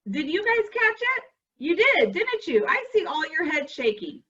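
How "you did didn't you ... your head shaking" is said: ordinary talk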